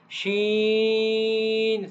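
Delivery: "sheen" stretched on a level note; sh-sound demonstration. Shown as a demonstration. Teacher modelling the simple letter, sustained "sheen". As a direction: start "sheen" with a full sh and hold the ee. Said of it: "it is the sound look like".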